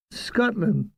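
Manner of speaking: put-on one word in a Scottish accent; Scotch accent. A narrator's reading. Sergeant tells where she is from, Scottish accent. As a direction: say it like a Scot, with accent. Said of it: "said in broad Scotch accent".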